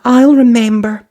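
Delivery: high, loud eager promise. A narrator's reading. Cinderella promising the fairy to leave by midnight, eager. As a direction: promise it brightly. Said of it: "Cinderella promised".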